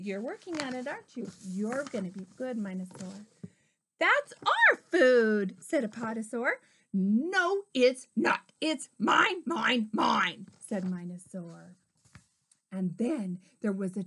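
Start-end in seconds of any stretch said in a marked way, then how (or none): 4.0-5.6 high, loud, slow protest; storybook dinosaur objecting loudly. A narrator's reading. 6.9-10.5 loud, raised greedy retort; storybook dinosaur insisting loudly; loud argument. A narrator's reading. Minosaur argues back in story.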